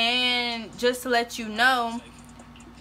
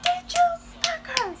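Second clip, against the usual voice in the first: very high, thin question; mock high-pitched interrogation; retold confrontation. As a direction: squeak the accusing question up high. Retold confrontation, asked a second time.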